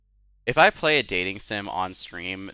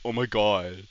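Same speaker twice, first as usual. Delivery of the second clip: put-on deeper alpha male voice; slower than usual. Streamer demonstrating her impersonation, mock alpha male voice. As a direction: lightly fake a deep macho voice. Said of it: "put on a deeper voice".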